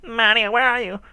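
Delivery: high, loud put-on game-character call; hammed-up high-pitched search call; cartoon Mario-brothers voice. Mario and Luigi cartoon impression.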